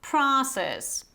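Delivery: American "pra" then "PRAH-sess", open vowel; American pronunciation. Shown as a demonstration. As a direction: show the American "ah" in process. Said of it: "instead of this O sound, you have this".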